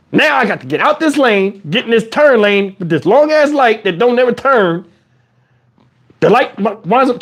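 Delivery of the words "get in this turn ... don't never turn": usual speaking voice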